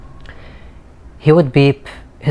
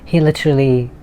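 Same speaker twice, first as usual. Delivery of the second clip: usual speaking voice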